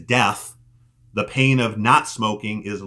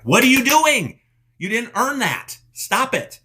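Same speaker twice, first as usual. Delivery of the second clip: mock scolding yell; 13 semitones higher than usual. Coach yelling at listeners who reward failure, sharp scolding. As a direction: snap at them like a drill sergeant.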